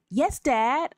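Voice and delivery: people-pleasing, rehearsed yes; robotic eager-to-please child. Retold or imitated. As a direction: answer dad like a dutiful, scripted child. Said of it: "I sound very robotic in real life".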